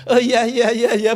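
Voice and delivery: high, loud hurried oh yes yes; flustered student. Retold or imitated. Caught-out student answers the lecturer, high and flustered. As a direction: blurt it like a caught-out kid. Student's startled reply, mimicked.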